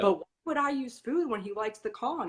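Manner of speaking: imitated baffled objection. Handlers questioning food for odor work, mock bafflement. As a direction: lightly mimic a doubtful client questioning you.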